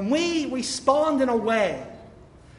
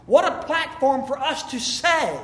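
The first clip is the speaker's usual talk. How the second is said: normal talking voice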